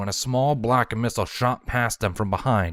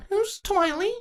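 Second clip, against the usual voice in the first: put-on curious child. Quelula asks Shining Armor who Twili is, high and curious. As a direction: ask it with wide-eyed curiosity.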